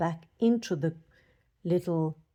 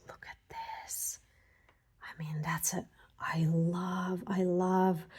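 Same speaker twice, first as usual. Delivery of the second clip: hushed whisper, much softer; awed whisper; 5 dB quieter than usual. Real, not acted. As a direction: breathe it out in awe. Awed over crystals, hushed.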